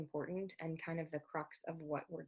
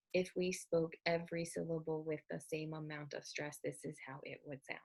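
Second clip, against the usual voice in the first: every syllable given the same stress, pitch held level; robotic, evenly timed syllables; equal syllable stress. Shown as a demonstration. Teacher showing syllable-timed English, robotic equal stress. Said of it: "sounds a little bit robotic".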